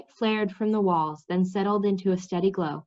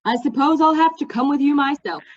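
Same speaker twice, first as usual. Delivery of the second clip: reluctant, sighing offer, a little louder; resigned sigh; 6 semitones higher, 5 dB louder than usual. Acted. Mendembar to Cimmerian, offering with a resigned sigh. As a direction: give in with a weary sigh. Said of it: "he sighed".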